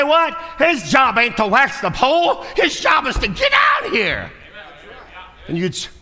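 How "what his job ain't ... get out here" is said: high, loud, indignant shout at the lazy firemen; outraged shout. Acted. Preacher voicing a furious caller at firemen, outraged shout. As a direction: bellow it like a furious chief.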